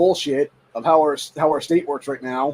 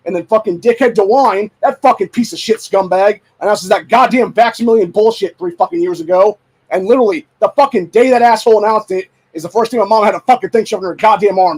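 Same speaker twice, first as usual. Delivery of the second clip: real angry rant, loud and raised; pissed off; 6 semitones higher, 8 dB louder than usual. Real, not acted. Blaming the announcement for his mom's shot, loud furious rant. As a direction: rage at full volume, punching the curses. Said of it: "That's what fucking pisses me off".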